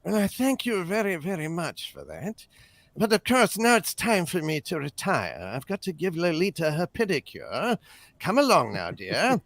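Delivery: suave, measured British actor voice; stuffy English film-star impression; James Mason. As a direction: put on an urbane English screen voice. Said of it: "Your James Mason impression is the best".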